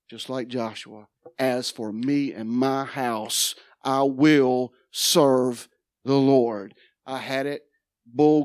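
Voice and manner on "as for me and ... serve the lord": very slow, measured declaration; solemn vow. Retold or imitated. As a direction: pronounce it like an oath. Quoting Joshua's vow.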